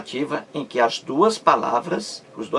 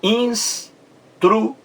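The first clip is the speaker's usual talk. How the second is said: Instruído split into ins, tru, ído, high and slow; syllable by syllable; 9 semitones higher, much slower than usual. Shown as a demonstration. Teacher showing sílabas de instruído, slow high syllable-by-syllable delivery. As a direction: pause between each chunk of Instruído. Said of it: "Veja as sílabas aí de instruído".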